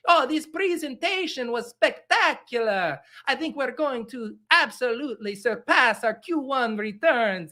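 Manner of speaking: hammed-up, strung-out Italian Mario voice; bad Italian accent. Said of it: "a shitty Italian accent".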